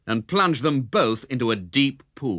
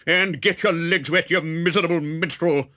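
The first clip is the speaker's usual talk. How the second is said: raised, higher mock roar; roaring friar; 7 semitones higher than usual. A narrator's reading. Friar ordering Robin back across the river, a raised roar. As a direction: bellow it down at him. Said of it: "roared the friar".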